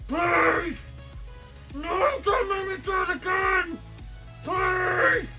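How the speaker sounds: high wailing plea, near tears; desperate pleading cry. Real, not acted. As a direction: beg the game in anguish. Begging game, crying out.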